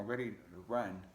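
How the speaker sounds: ordinary delivery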